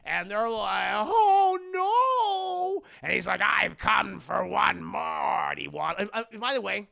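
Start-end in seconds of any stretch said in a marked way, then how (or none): 0.9-2.9 high, loud, drawn-out wail; hammed-up alarm; mock dismay. Party guests voiced in dismay. 3.4-5.5 slow, loud, raspy villain voice; hammed-up raspy villain proclamation; Danny DeVito's Penguin. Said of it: "Danny DeVito's penguin's like".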